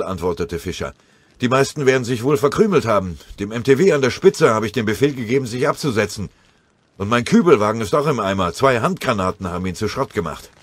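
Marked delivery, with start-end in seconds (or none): 1.4-10.5 gehetzt. Acted. Oberfeldwebel berichtet gehetzt.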